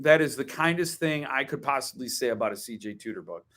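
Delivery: usual speaking voice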